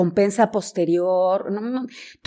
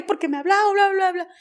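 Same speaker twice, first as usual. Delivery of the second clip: high-pitched mock tearful babble; imitación de llanto; 10 semitones higher than usual. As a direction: imitate an upset reporter's complaint, much higher. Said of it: "llorándome a la oficina". (imitando una queja llorosa, muy aguda)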